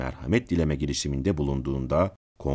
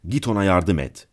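louder, higher order; brisk instruction in a raised, higher voice; 4 semitones higher, 6 dB louder than usual. A narrator's reading. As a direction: say it aloud for all to hear. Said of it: "Sonra yüksek sesle".

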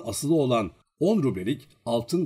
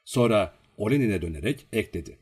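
usual speaking voice

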